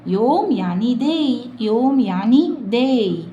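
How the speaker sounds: slow emphatic. Shown as a demonstration. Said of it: "slow emphatic model".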